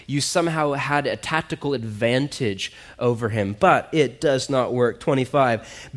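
ordinary talk

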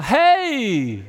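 loud, high, beaming hey with a laugh in it; bright excited greeting. Retold or imitated. Preacher imitating the cheerful Canadian coworker, loud beaming hello. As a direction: greet the whole office like you are thrilled to see them. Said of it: "Big smile".

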